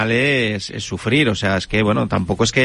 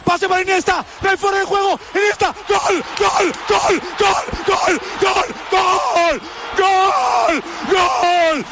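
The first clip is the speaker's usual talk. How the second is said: breakneck goal commentary; slower than usual. Real, not acted. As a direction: yell gol over and over at the top of your voice. Famous Iniesta goal radio clip.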